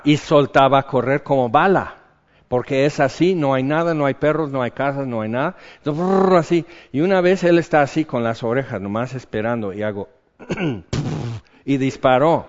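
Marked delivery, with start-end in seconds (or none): 6.0-6.3 lip trill "brrr" for speed; imitated gallop. Preacher mimicking his horse galloping, loud lip trill. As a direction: buzz the lips like hooves racing.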